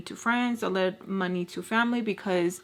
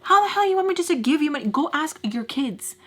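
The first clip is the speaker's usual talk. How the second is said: indignant retort; 7 semitones higher, 4 dB louder than usual. Retold or imitated. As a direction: fire back at grandma in raised-pitch disbelief. Retorting to grandma about money.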